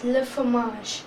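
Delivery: le fromage said luh fro-MAZH, slowly; French le fromage. Shown as a demonstration. Teacher modelling the French word for cheese. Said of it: "Then we have cheese".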